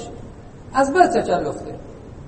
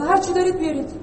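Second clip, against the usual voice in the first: voicing host; 8 semitones higher than usual. Host's plain offer.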